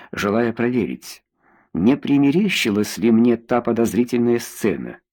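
ordinary delivery, nothing marked

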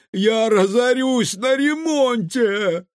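high, loud, slow lament; despairing wail. A narrator's reading. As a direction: wail it out, stretching the words. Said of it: "схватился за голову управляющий".

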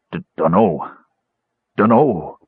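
cowering, stammered denial; lightly voiced frightened creature; terrified stammer. A narrator's reading. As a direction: shrink away while answering. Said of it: "cowered in terror".

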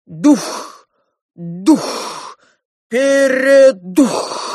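loud chant with a long drawn-out finish; ritual shout. A narrator's reading. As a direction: cry it out like priests summoning a spirit. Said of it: "дружно закричали они".

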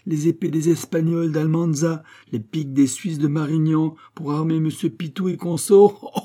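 the abbé scoffs, high and loud; scornful mockery. Abbé scoffing at Pitou's arms request, loud scorn. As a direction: sneer at the very idea.

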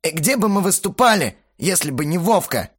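high, indignant retort; flaring up. A narrator's reading. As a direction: flare up in protest. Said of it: "взвился Бельфигор". (вспылив, возмущённо)

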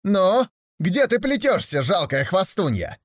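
high, slow jeering call; mocking call. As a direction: jeer back over the shoulder. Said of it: "крикнула насмешливо". (насмешливый крик)

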